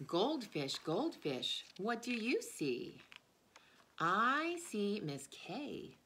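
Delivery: sing-song read-aloud rhythm; reading to children. Acted. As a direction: read it aloud for little ones, bouncy and clear. Reads picture book to children.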